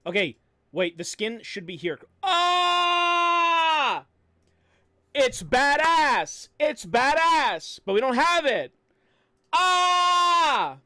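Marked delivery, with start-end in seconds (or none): 2.2-4.1 high, loud shriek of delight; thrilled high scream; excited scream. Real, not acted. Shout at seeing the skin. 5.1-8.7 high and loud, slow hyped yelling; loud thrilled slow yell; excited shouting. Real, not acted. Excited shouting about skin. 9.5-10.8 high, loud shriek of delight; thrilled high scream; excited scream. Real, not acted. Shout again.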